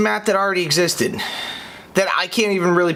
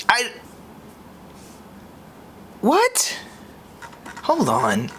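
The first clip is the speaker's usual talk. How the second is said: shocked what; 5 semitones higher, 5 dB quieter, slower than usual. Real, not acted. Stunned by the announcement.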